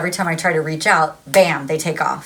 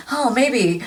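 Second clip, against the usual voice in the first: high, tentative mock musing with laughter around it; quick little maybe; 5 semitones higher than usual. Reader mimicking someone's brief second thought, quick little maybe. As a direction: toss out a tiny passing thought, amused. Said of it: "it's a quick little maybe".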